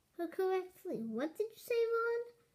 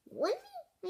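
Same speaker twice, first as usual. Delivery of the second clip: high, shocked "Warn me?" leaping way up; startled interruption; incredulous. Acted. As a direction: cut in with disbelief. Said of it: "I interrupted".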